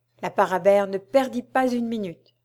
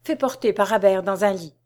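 plain reading voice

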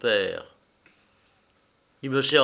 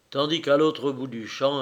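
ordinary delivery

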